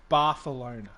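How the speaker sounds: Barcelona with a lisped th for the c; pronunciation demo. Shown as a demonstration. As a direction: say Barcelona as "Barthelona". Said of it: "It's pronounced Barcelona".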